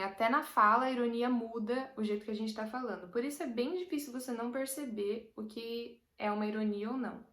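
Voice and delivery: normal talking voice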